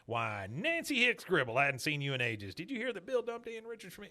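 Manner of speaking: high-pitched mock gushing; put-on social delight; showy greeting. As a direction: imitate bragging to a friend, rising high and quick. Lenore shows off to a friend.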